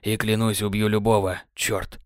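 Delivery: Sam's vow, fading out. Acted. Sam, voice fading. (голос Сэма, затихая)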